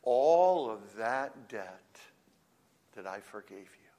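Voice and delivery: ALL drawn out slowly, voice falling after; stress on ALL. Shown as a demonstration. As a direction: read it slowly, weighting "All". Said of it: "You could read it this way".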